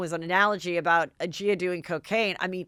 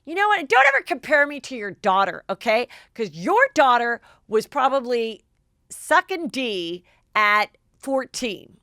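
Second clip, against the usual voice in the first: high, loud angry comeback; furious retort; 5 semitones higher, 5 dB louder than usual. Retold or imitated. Leah storming back at Ramona, loud furious retort. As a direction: tear into her for the comparison. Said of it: "So Leah storms back".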